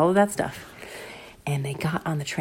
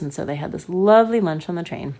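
plain reading voice

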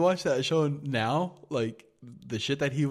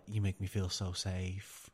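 mock swooning whisper; 5 semitones lower, 8 dB quieter than usual. Mocking car lover to car.

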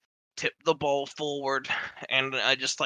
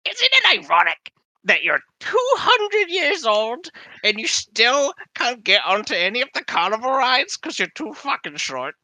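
mocking, laughing; 11 semitones higher, 6 dB louder than usual. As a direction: tease him while cracking up. Retold mocking quote.

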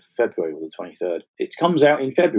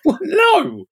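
mock incredulous. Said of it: "And I was like".

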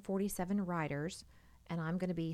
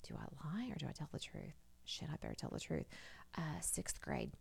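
sheepish inner monologue; 9 dB quieter than usual. Retold or imitated. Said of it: "And I'm like".

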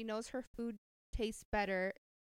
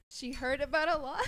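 high giggly read; trying not to crack up; laughing while reading; 6 semitones higher, 7 dB louder than usual. Real, not acted. Second try, still laughing.

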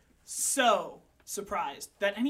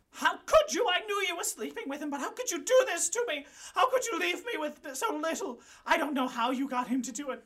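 much higher, louder furious accusation; a betrayed widow screaming at the mistress; screaming Lola; 9 semitones higher, 6 dB louder than usual. Acted. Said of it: "Lola starts screaming at you".

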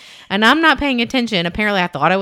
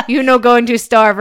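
mock Doctor Now with a laugh, louder; laughing impression; 4 semitones higher, 6 dB louder than usual. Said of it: "the best impression".

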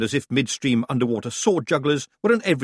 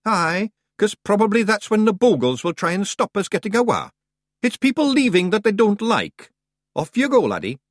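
high, loud broad Scottish pixie voice; a cocky wee Scots fighter; tiny Scots warrior; 10 semitones higher, 5 dB louder than usual. Acted. Rob Anybody answering the boy, high broad Scots.

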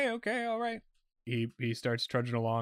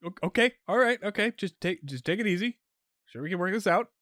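captive cop, a bit higher and placating; nervous cop; 8 semitones higher than usual. Acted. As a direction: soothe the kidnapper, rising okays.

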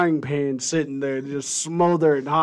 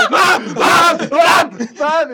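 much higher, louder frantic calls for mom; panicked yelling; 12 semitones higher, 7 dB louder, much slower than usual. Retold or imitated. Child sees a seagull and yells for mom, panicked. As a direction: scream for mom as if the monster is coming. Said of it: "freaking out at seagull".

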